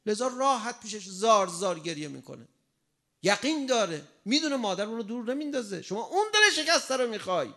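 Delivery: loud, high impassioned preaching; a preacher lifting the crowd to tears and joy; preaching at full force. Acted. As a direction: pour it out to the congregation with full fervour. Fervent sermon opening.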